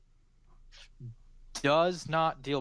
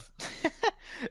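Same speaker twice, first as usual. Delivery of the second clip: embarrassed laugh. Real, not acted. GM laughs at own mistake.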